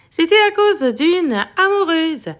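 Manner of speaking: ordinary delivery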